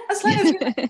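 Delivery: high, loud laughing repeat. Real, not acted. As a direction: laugh through the words.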